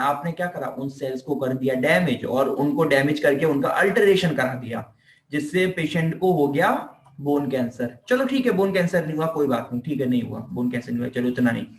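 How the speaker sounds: normal talking voice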